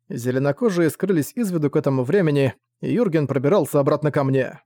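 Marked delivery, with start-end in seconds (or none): none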